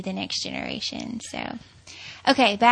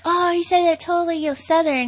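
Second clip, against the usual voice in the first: high, louder gotcha, retold; retold teasing; 8 semitones higher, 5 dB louder than usual. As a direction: imitate yourself catching him out, pitched up. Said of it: "I'd be like".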